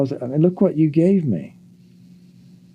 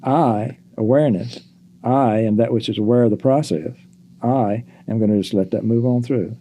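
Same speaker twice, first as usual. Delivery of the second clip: ordinary talk, nothing marked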